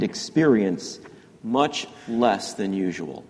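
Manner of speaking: measured reading, long pauses between phrases; slow reading. Real, not acted. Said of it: "Let me read that one more time".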